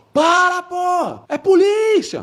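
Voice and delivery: high-pitched, drawn-out yell without full volume; re-enacted police shout. Plainclothes officer calls to a uniformed one, high mock yell. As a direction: imitate calling out across gunfire. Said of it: "comecei a fazer assim".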